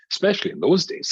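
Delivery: ordinary talk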